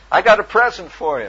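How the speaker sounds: loud, bright and high offer; cheery call. Retold or imitated. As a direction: sell it like good news. Cheery call to hidden mechanic.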